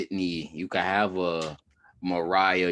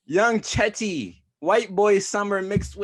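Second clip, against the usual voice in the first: hype voice; 12 semitones higher, 6 dB louder, slower than usual. Real, not acted. Hyping up the joke pick.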